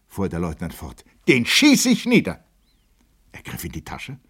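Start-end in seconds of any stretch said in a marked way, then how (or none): 1.3-2.4 very high-pitched, forceful threat; furious threat. A narrator's reading. Lieutenant warns officers before reaching for pocket, furious threat. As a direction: finish the threat in a raised voice.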